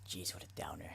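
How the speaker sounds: whispered aside, under the breath; a hushed private comment. Real, not acted. Aside about a gloomy lady.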